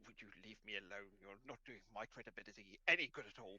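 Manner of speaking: low, soft, grumbling dog; lightly voiced dignified hound; embarrassed pack leader. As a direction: protest under your breath to save face. Cool dog resists fussing.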